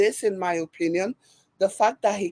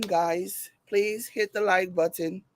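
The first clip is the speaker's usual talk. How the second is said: ordinary delivery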